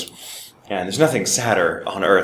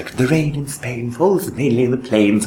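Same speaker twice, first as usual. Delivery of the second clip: slow, high-pitched British elocution; fabulously British; 6 semitones higher than usual. Retold or imitated. British speech teacher drilling the student, fabulously British. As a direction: stretch it out in grand old British diction. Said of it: "fabulously British".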